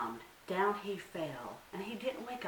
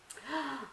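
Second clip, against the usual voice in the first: gasp; 8 semitones higher than usual. A narrator's reading. Dramatic gasp.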